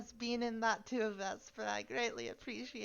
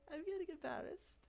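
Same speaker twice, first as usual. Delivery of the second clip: embarrassed; 8 semitones higher, 8 dB quieter than usual. Real, not acted.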